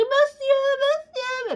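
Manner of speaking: high, drawn-out shout after someone leaving; calling out loud. Retold or imitated. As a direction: call him back at the top of her voice. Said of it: "je crie". (crier «Monsieur, monsieur!» très haut et lentement)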